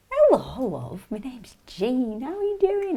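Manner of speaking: high, slow put-on character voice; drawn-out high voice as a chatty factory worker; Jean. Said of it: "You get right into character".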